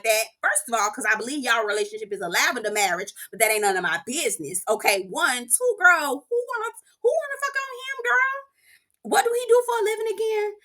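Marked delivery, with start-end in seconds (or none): 5.4-10.5 high squeal of disbelief; mock shock, pitched way up; incredulous. Real, not acted. Mocking disbelief at Mike.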